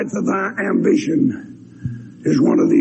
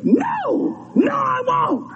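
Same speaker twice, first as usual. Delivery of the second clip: defiant refusal; much slower than usual. Retold or imitated. Girl defiantly refusing the preacher.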